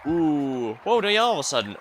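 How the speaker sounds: startled, pitch jumping up; mock yell at something sudden; acted startled cry. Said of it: "yelling such a loud voice".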